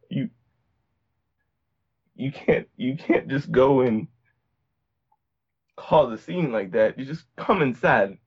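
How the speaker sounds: ordinary talk, nothing marked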